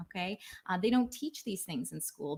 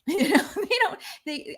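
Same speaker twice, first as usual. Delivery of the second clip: laughing; 11 semitones higher, 9 dB louder than usual. Real, not acted. Laughing at own example.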